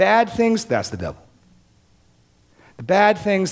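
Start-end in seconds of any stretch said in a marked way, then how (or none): 0.1-0.7 high, louder mock tone on bad things; lightly mocking a view he rejects; mock incredulous. Preacher mocks a shallow theology, high and louder. 2.8-3.5 high, louder mock tone on bad things; lightly mocking a view he rejects; mock incredulous. Preacher mocks a shallow theology, high and louder.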